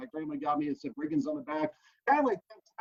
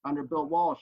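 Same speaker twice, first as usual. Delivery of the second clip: ordinary talk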